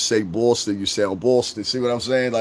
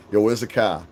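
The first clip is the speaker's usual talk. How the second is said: "car" said "cah" in a Boston-New York accent. Shown as a demonstration. Showing how both cities say car, Boston-New York accent. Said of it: "say the same all the time is car".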